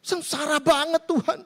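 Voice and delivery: high, hammed-up whimpering to God; rising into a mock wail on Oh; mock wailing lament. Troubled believer laments his miserable life, hammed-up wail. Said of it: "katanya begitu".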